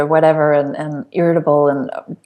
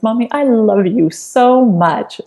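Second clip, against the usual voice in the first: put-on high, slow, sweet child's voice; little girl; 4 semitones higher, much slower than usual. Four-year-old daughter hugs her mom, slow and sweet. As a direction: play a four-year-old saying it tenderly. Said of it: "blue eyes and says".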